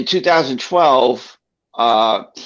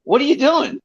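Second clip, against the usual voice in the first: high-pitched astonished question; startled retold reaction. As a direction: voice a stunned crowd, pitched way up. Courtroom startled by the yell, retold.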